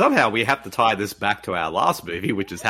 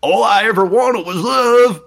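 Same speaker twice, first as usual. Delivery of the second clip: mock lovesick monster; 10 semitones higher, 6 dB louder than usual. Mocking a movie character.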